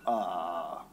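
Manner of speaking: low, stunned "uh"; acted speechless hesitation, pitched right down; reluctant groan. Acts out his reluctant reaction to God.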